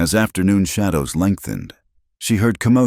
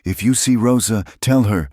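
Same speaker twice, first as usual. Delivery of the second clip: urgently; 6 semitones higher than usual. Acted. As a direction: press the request, hurried and pleading. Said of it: "Clara said urgently".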